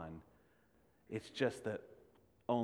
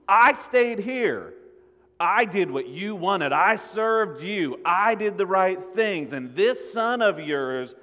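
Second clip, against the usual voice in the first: indignant complaint; 7 semitones higher, 10 dB louder, slower than usual. Acted. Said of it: "I mean".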